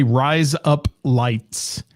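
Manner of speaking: each word drawn out very slowly; slowly spelled out. Shown as a demonstration. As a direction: say it slow and deliberate, word by word. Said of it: "if you say".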